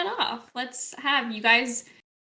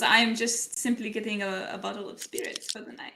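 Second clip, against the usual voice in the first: put-on French accent; 2 semitones lower, 8 dB quieter than usual. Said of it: "So Fremont goes".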